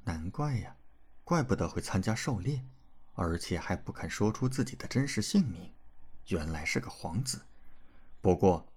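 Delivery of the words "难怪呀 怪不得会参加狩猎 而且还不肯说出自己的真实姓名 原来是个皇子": soft murmur, much quieter; murmuring to herself. A narrator's reading. Swordswoman realizing he is a prince, murmuring softly. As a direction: mutter it under her breath. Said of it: "喃喃道".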